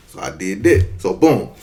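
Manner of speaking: ordinary delivery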